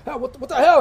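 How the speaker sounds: guard caught off guard by fans; startled guard. Acted.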